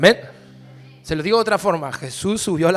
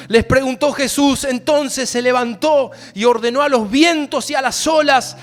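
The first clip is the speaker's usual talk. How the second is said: loud, slow, higher pulpit shout; storm preaching; 7 semitones higher, 8 dB louder, slower than usual. Acted. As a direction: drive the storm home, stretching the words. Preacher narrates the calm.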